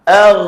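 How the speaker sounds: Ar loud, ghain held thick. Shown as a demonstration. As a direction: hit Ar hard with tongue root lifted. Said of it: "Dia bunyi meninggi".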